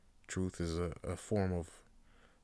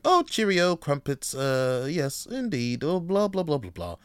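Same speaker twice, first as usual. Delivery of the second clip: hammed-up posh British accent, pitched higher; mock British accent; 6 semitones higher, 5 dB louder than usual. Mocking British colonizers nobody questions, in a hammy British accent. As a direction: lampoon a plummy British gent in a British accent. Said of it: "throw a British accent on it".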